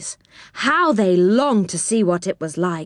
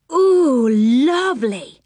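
louder, much higher slow ooh of pleasure; delighted; 5 semitones higher, 3 dB louder, slower than usual. A narrator's reading. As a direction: savour it with delight. Child delighted by lollipops.

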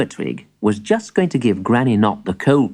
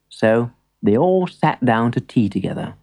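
ordinary delivery, nothing marked

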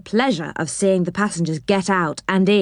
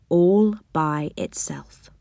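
ordinary delivery

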